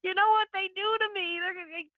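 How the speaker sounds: high, pleading cry of distress; waking in tears, pleading against the call. Retold or imitated. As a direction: beg in fear, voice up high. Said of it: "I would wake up at night in tears going".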